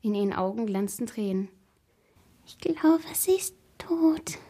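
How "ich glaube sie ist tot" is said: slow, high, squeaky whisper; breathed whisper. A narrator's reading. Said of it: "hauchte die Frau". (hoch und piepsend gehaucht)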